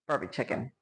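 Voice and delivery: usual speaking voice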